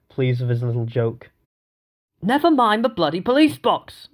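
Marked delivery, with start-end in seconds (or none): 2.2-4.1 loud, high furious roar. A narrator's reading. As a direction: bellow it at the suspect. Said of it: "roared Foster".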